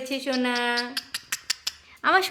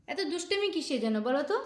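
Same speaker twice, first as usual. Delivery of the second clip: usual speaking voice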